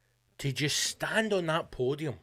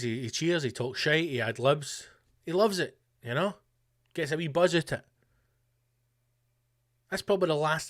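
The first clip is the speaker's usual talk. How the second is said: ordinary delivery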